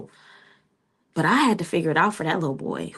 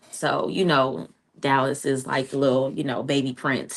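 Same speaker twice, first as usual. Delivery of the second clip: ordinary talk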